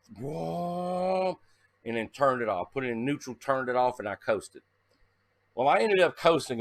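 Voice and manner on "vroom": engine rev. Retold or imitated. Imitating engine revving.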